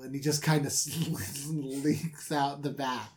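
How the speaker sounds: ordinary talk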